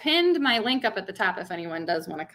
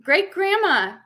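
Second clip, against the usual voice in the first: imitated toddler announcing a visitor, pitch jumping up; two-year-old; 3 semitones higher, slower than usual. Toddler tells mother who is coming, imitated child voice. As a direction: mimic a small child calling Mama. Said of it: "my two-year-old, goes".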